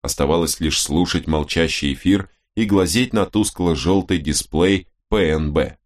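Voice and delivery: ordinary delivery, nothing marked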